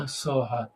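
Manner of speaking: mock growl collapsing into a sigh; angry then sighing. Coach mimicking students with women, growl then sigh. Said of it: "and then they sort of sigh".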